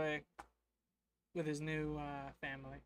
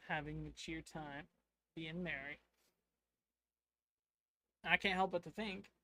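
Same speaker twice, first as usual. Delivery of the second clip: ordinary talk, nothing marked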